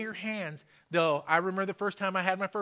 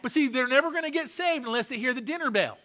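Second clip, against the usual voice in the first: raised, higher-pitched sermon voice; fervent preaching; 6 semitones higher, 5 dB louder than usual. Real, not acted. Preacher urging congregation to testify of miracles, fervent preaching. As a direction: drive it home to the congregation with force.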